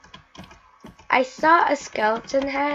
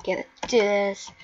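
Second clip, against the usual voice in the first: usual speaking voice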